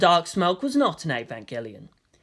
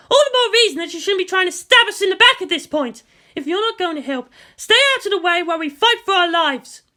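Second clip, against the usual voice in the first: louder, sharp commander pushing back; defiant retort; 13 semitones higher, 6 dB louder than usual. Acted. Misato snapping back at the president, louder and defiant. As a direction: refuse to back down.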